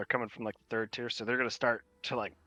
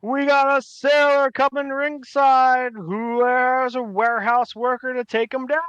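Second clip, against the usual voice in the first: high, very loud, stretched-out announcer holler; sustained arena shout, every word drawn out; halfling ring announcer; 12 semitones higher, 11 dB louder, slower than usual. Acted. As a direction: call out the fighters to the crowd. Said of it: "the halfling says".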